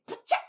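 very loud sharp slap cry; slap sound. Acted. Storyteller voicing the second slap, very loud. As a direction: bark out the slap noise.

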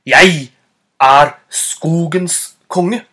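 loud, slow, emphatic proclamation; commanding self-declared king; clearest, most determined voice. Acted. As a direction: declare it firmly, word by word. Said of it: "med den tydeligste og mest bestemte stemmen".

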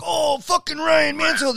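high, slow, booming hello; excited greeting. Retold or imitated. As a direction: hail him like an old buddy. Big guys greeting him loudly.

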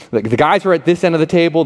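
ordinary talk, nothing marked

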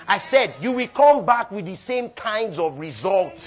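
loud, high, stretched-out declaration; full-force preaching. Real, not acted. Preacher warning the congregation about their results, full-force preaching. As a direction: drive it home to the crowd. Repeats it for the crowd.

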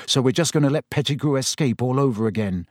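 ordinary delivery

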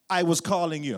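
loud, scolding Jamaican dad voice; imitated father's indignant reproach. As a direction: confront the son who ignored you. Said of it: "Jamaican father".

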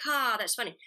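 one tired ha, the joke already wearing off. Shown as a demonstration. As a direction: say it like you've heard it before. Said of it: "then you do it at the table reading".

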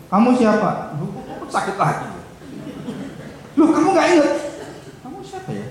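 high, bewildered voices with a laugh; forgetful husband. Retold or imitated. Husband with Alzheimer not knowing his wife, high bewildered voice. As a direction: play the confused couple.

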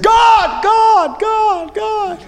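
high desperate shout fading away with each God; desperate cry. Pretend. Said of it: "you're desperate".

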